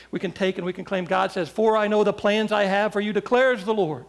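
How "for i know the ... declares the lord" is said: loud, emphatic declaration of God's promise; raised preaching voice; scripture proclamation. Acted. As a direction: proclaim it firmly to the congregation.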